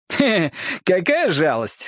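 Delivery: chuckle, then feign sympathy; gloating rival. Acted. Джим meets his dying rival, gleeful mock pity.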